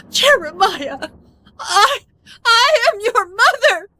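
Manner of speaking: hurt mother crying and pleading; tearful plea. Acted. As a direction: sob out the protest to her son. Mother thrown out, weeping.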